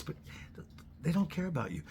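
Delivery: usual speaking voice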